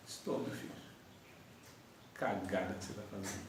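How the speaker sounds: very soft, low voice; hushed, long-suffering father; resigned fatherly reply. Acted. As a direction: answer low and patient, under the breath. God wearily answering the preacher.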